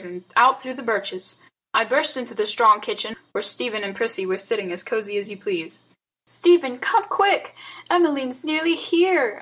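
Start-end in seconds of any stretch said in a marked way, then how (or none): 6.3-9.4 higher, urgent call; urgent cry. A narrator's reading. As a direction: hurry him, alarmed. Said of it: "I cried".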